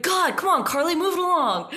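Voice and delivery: high, loud mock nagging; impatient coworker. Coworker behind giving her a hard time, impatient shout. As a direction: imitate an exasperated coworker shouting to hurry up. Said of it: "giving me a hard time".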